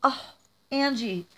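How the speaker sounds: ordinary talk, nothing marked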